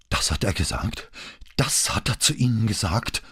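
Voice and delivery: breathless, appalled disbelief; shocked gasping. A narrator's reading. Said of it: "keuchte sie". (fassungslos keuchend)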